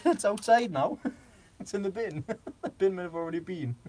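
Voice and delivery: giggling through each line; real laughter breaking through; laughing. Real, not acted. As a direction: teller cracking up while retelling the husband's answer. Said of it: "I was killing myself laughing".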